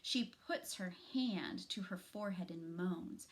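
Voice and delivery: plain reading voice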